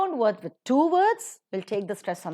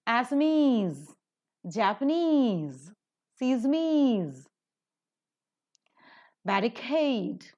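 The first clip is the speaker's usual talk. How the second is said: Burmese to however, each word's suffix drawn out; word list, lean on -ese, -ade and -ever; isolated words like Chinese, lemonade, however, endings accented; stress on the ending; slower than usual. Shown as a demonstration. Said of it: "all these are stressed in the last".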